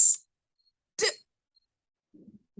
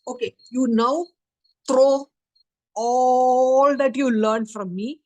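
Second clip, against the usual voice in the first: retold angry order; 4 dB louder than usual. Teacher scolds and expels student.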